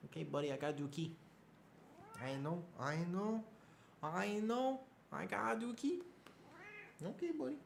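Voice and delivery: high, soft cooing to a pet; pet-talk voice. Real, not acted. Owner talking to his cat buddy, high soft cooing. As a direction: climb into a tiny, hushed falsetto coo.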